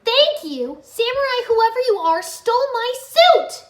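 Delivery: high, loud, slowing outburst; angry shout. A narrator's reading. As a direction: yell in indignation. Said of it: "Nia shouted".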